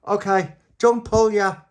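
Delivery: high, loud shouted order; calling out. Acted. Little Pappy to the pullers, calling out. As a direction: shout it through to them.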